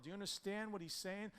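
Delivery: normal talking voice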